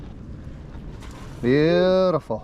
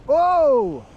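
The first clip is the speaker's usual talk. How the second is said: surprised; 11 semitones higher than usual. Real, not acted. Big fish hits the line.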